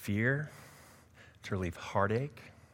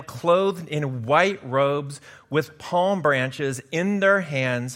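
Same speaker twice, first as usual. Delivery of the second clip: louder, higher, slowing on the robes; proclaimed scripture; 6 semitones higher, 9 dB louder, slower than usual. Acted. Pastor reading Revelation seven to the church, proclaiming. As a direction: proclaim the vision like a herald. Reads Revelation passage loud.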